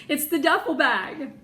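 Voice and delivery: usual speaking voice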